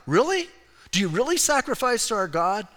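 high-pitched doubting question; acting out a sceptical comeback; mock incredulity. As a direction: squeak up in disbelief on the first word. Acted sceptical reply to Samaritans.

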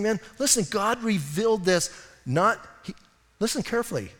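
normal talking voice

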